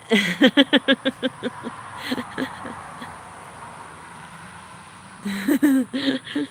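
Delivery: high burst of laughter, trailing off; long real laugh. Real, not acted. Livestreamer reading friends' chat, long laughing fit. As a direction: laugh helplessly, letting it fade out slowly.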